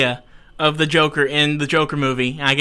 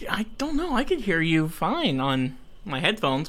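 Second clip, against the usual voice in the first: ordinary talk, nothing marked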